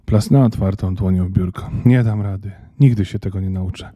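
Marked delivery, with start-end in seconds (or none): none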